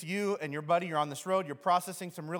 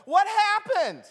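much higher, louder sing-song mock question; nosy asker; 10 dB louder, slower than usual. People pester someone grieving, chirpy mock question. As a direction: imitate a prying, chirpy questioner. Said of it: "people are like".

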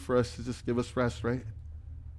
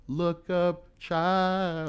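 quoted lyric; 4 semitones higher, much slower than usual. Retold or imitated. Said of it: "famous poet that said these words".

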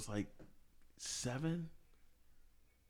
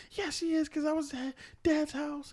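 put-on higher, slower child's voice, proud; little daughter; 9 semitones higher, slower than usual. Daughter proving to mom Santa is real, higher child voice. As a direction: pitch up and lay out the proof like a small girl. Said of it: "my daughter goes".

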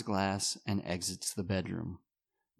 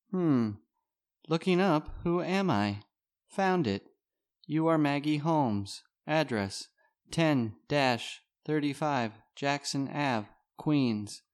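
robot voice; 6 semitones higher, 3 dB louder, slower than usual. Acted. Voicing the smartphone voiceover.

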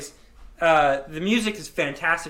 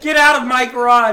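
mock band boss; 6 semitones higher, 11 dB louder than usual. Joking about kicking out bandmate.

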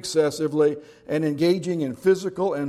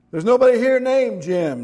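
sharp, higher and louder; irritated; 8 semitones higher, 7 dB louder than usual. Acted. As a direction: snap at the caller. Sleepy man irritated on phone.

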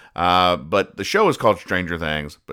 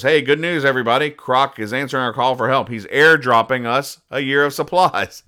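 higher, louder cheerful radio call; excited; 6 semitones higher, 4 dB louder than usual. Retold or imitated. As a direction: announce the good news with glee. Soldier on radio announcing news.

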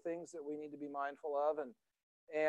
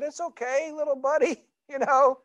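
put-on high, gentle consoling of a tearful boy; comforting his little son; 9 semitones higher than usual. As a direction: soothe him, coax him to buck up. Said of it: "I said".

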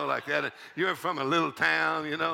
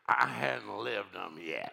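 put-on quiet old man's reply; 11 semitones lower, 6 dB quieter, slower than usual. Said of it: "And he said".

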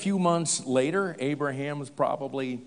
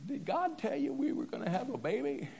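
high-pitched, quick, softer Sarah voice; doubtful wife; 9 semitones higher, 7 dB quieter, faster than usual. Acted. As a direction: ask it high and quick, as an old wife questioning her husband. Voicing Sarah questioning Abraham.

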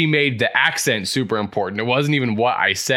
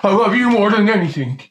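gushing love; 6 semitones higher, 4 dB louder, faster than usual. Acted. Acted scene declaring love.

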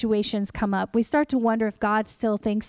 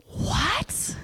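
breathless whispered "What?"; stunned whisper; 8 semitones higher, 4 dB quieter than usual. Retold or imitated. Mother recalls the surprise phone call in a stunned whisper. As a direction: gasp it out in disbelief.

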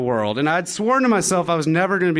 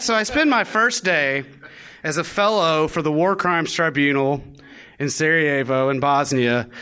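ordinary talk

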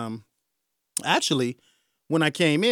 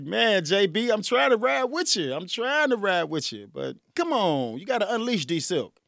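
pleading with the governor; 7 semitones higher than usual. Pretend.

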